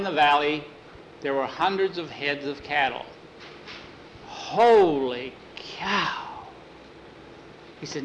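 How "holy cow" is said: amazed. Acted. Sees hundreds of cattle.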